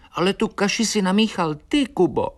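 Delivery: high-pitched little swallow's reproach; reproachful. A narrator's reading. Swallow blames Kuba for mixing the concrete, high and reproachful. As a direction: blame him in a small, high voice. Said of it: "vyčítavě se na mě zahleděla Eliška".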